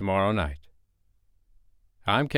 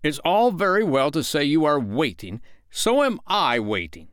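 high, emphatic grumbling comeback; indignant retort; 6 semitones higher, 4 dB louder than usual. A narrator's reading. Father answering waiting Michael, indignantly. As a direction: argue back like a petulant father. Father snaps back in quarrel.

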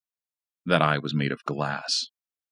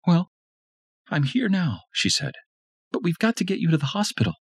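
higher woman's voice, fast at the end; urgent Tanya. A narrator's reading. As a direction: hurry him along firmly. Tanya urges him.

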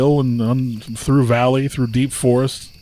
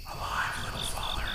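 barely audible tiny whisper; faint whisper; 15 dB quieter than usual. A narrator's reading. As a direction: whisper it from inside a sack. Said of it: "a little voice, hardly to be heard".

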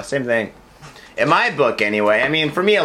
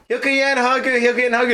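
hawker calling out; 8 semitones higher than usual. Retold or imitated. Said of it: "I'm just like".

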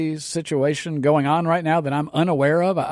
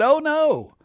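sarcastic; 12 semitones higher than usual. Acted. Mocking the medical establishment.

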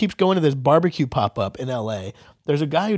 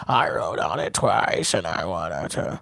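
RFK impression; 4 semitones lower, 4 dB quieter than usual. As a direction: drag it out like a tired old man. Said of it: "he was like".

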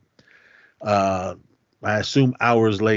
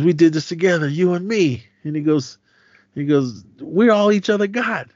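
pleading; 7 semitones higher, 3 dB louder than usual. Retold or imitated. G Money pleading at gunpoint.

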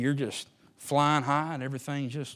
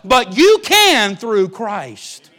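very slow, loud and high; climactic preaching; 9 semitones higher, 16 dB louder, much slower than usual. Acted. Preacher to congregation on strength in Christ, slow loud climax. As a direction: land the line like a hammer.

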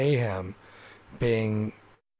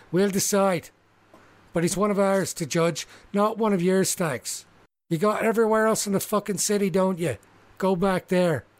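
higher, hard-edged warning; hard halfling with crossbow; 6 semitones higher than usual. Acted. Crossbow halfling telling outsiders to go back, menacing warning. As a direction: lay down the law, menacing.

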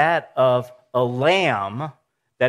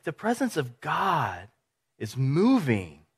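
ordinary talk, nothing marked

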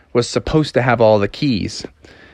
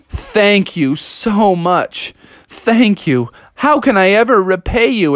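higher-pitched, heartfelt thanks; gushing gratitude; 7 semitones higher, slower than usual. Acted. Froggy thanks the janitor who freed him, gushing. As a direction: draw out the thanks, overwhelmed with relief. Rescued frog gushes thanks.

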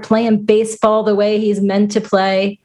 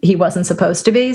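ordinary talk

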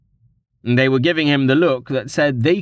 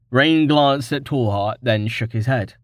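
ordinary delivery, nothing marked

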